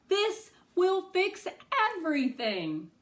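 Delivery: high, loud gleeful crowing. Acted. Girl crows to Noah about finding the sister. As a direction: crow it out in delight. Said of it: "I crow".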